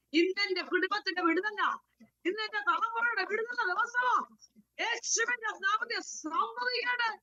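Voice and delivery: high-pitched prophetic declaration, climbing line by line; impassioned preaching. Real, not acted. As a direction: proclaim it over the congregation, pitch rising to a peak. (ഉയർന്ന സ്വരത്തിൽ ആവേശത്തോടെ പ്രഖ്യാപനം)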